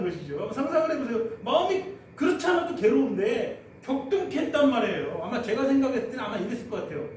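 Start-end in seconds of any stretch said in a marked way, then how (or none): none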